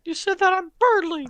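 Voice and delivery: high squeaky cartoon voice, mock hurt; Mickey Mouse. Offended character accuses the hosts, squeaky cartoon mouse. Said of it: "stop being Mickey Mouse".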